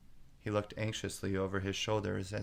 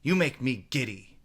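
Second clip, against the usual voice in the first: higher and louder complaint; irritated queen; 4 semitones higher, 7 dB louder than usual. A narrator's reading. Queen complains to the bowing gardeners. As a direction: fling the annoyance at them. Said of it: "screamed the queen".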